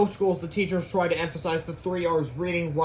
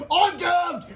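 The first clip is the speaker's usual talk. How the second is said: very high, loud wail; doomed hero; 13 semitones higher, 6 dB louder than usual. Acted. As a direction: cry out in mock despair. Imperilled hero wails.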